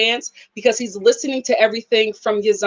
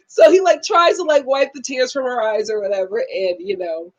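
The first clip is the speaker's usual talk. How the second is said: ordinary talk, nothing marked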